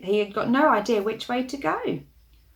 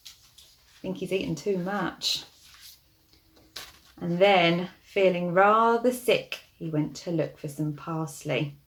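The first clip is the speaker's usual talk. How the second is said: plain reading voice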